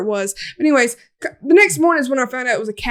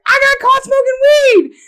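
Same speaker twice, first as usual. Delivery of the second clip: loud, high re-enacted blurt; yelled confession; 12 semitones higher, 6 dB louder than usual. Confessing to friend in the car, loud high blurt. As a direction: shout the confession with pitch way up.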